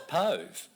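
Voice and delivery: "pove" with the long O of "stove"; rhymed with stove. Shown as a demonstration.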